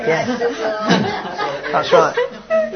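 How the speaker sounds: high squeak of laughter through the words; laughing. Real, not acted. Laughs at a silent congregation.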